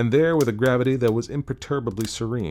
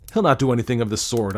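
quick, louder, higher protest; crying out; 4 semitones higher, 6 dB louder, faster than usual. A narrator's reading. Narrator contradicts Wish's warning about Clayton, crying out. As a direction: cry it out in hasty objection. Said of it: "I cried".

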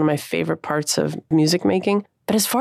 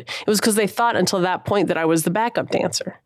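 ordinary delivery

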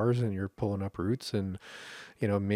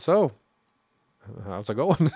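casual greeting; 4 semitones higher, 8 dB louder than usual. Acted. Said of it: "almost like a greeting".

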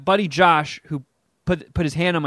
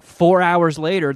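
ordinary talk, nothing marked

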